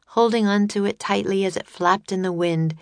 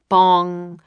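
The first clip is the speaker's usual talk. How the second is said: mock bell bong; 5 dB louder than usual. A narrator's reading. Clock striking.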